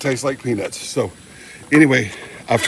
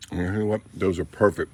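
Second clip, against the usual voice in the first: ordinary talk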